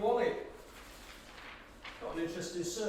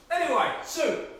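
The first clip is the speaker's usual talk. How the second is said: high, loud chirpy announcement; bright, triumphant; cheery announcement; 8 semitones higher, 7 dB louder than usual. Acted. Frank announces food.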